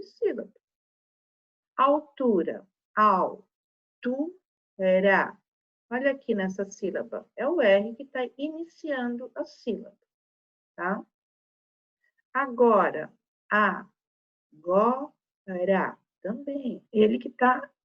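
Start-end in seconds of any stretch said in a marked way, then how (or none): 3.0-5.4 "al, tu, ra" com pausa entre as sílabas; separando sílabas. Shown as a demonstration. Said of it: "Se eu separar sílabas". 13.5-16.0 "a, go, ra" com pausa entre as sílabas; separando sílabas. Shown as a demonstration. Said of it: "Se eu separar sílabas".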